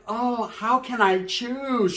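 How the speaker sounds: slow, airy put-on girl voice; mock coy girl. Comedian mimics the girl rubbing on both men, slow and coy. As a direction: coo it, pretending she can't decide.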